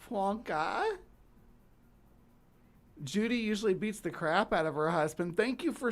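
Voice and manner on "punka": British accent. Shown as a demonstration. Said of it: "helps me with my British accent to say that word".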